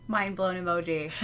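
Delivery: normal talking voice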